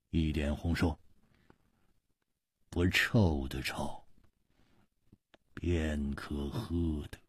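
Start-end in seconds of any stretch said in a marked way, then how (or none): 2.7-7.2 low, hushed, drawn-out reply; cold. Acted. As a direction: answer slowly with icy restraint. Cold swordsman answers the nervous boss.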